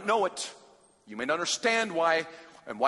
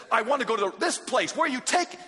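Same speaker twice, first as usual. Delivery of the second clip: higher, demanding questions; upset passenger; 7 semitones higher, 3 dB louder than usual. Retold or imitated. Traveler confronting cab driver, alarmed and demanding. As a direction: press the driver hard.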